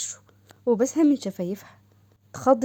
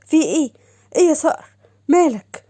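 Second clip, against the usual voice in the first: put-on startled girl; 6 semitones higher, 8 dB louder than usual. Doha startled by his gasp.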